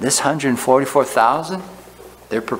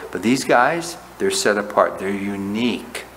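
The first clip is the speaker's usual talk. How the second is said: usual speaking voice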